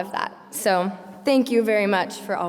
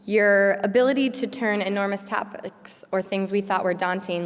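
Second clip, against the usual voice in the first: usual speaking voice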